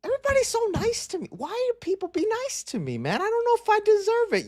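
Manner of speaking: high-pitched and fast, playing his own disbelief; mock bewildered self. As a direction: squeak it out like you can't believe your luck. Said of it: "I'm like".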